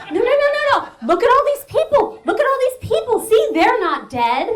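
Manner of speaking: high, loud, urgent pleading; frantic reassurance. Retold or imitated. Mother trying to hush her daughter on the plane, frantic reassurance. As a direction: talk over a screaming child.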